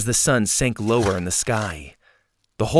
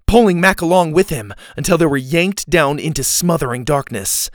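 usual speaking voice

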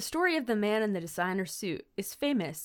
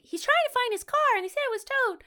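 put-on very high little-boy voice; Reese; 3 dB louder, faster than usual. Eleven-year-old explaining to the mechanic, little boy voice. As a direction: explain as a hammed-up earnest kid. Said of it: "Reese is just like".

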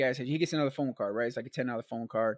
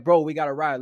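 louder, pressing demand; urgent plea; 9 dB louder than usual. Retold or imitated. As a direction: push the friend to help now. Urging Chunk on the phone.

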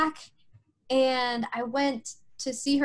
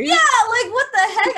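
much higher and louder, what the heck; incredulous; 10 semitones higher, 8 dB louder than usual. Real, not acted. Telling the host how baffled she felt, incredulous outburst. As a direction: blurt it out in wide-eyed disbelief. Recalling her shock, incredulous.

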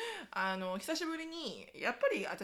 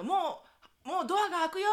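bossy mom calling; 7 semitones higher, 5 dB louder than usual. Retold or imitated. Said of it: "言ってるその口調もね、かなりな口調".

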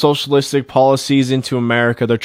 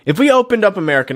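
higher and fast, driving the point home; emphatic repeat; 6 semitones higher, much faster than usual. Real, not acted. Pushing his idea on listeners, higher and fast. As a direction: hammer the idea again, quicker and higher. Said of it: "Is if we opened up America".

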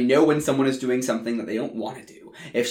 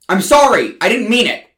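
loud, high shouted apology; shouting apology; 9 semitones higher, 13 dB louder, faster than usual. Acted. Songwriter chasing the singer down the street, shouting. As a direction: yell it after her as she runs. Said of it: "I'm shouting this as I chase her".

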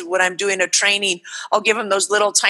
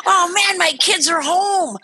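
put-on high, tearful delight at kids being home; joyful homecoming parent; 9 semitones higher than usual. Parent walking in the door, overjoyed and teary. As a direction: near tears with joy, calling to the kids. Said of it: "I'm getting goosebumps".

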